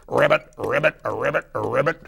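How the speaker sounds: high, loud rolled ribbits; trilled frog call; Beauregard the Frog. Acted. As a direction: croak in a quick string. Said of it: "Beauregard the Frog".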